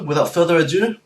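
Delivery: normal talking voice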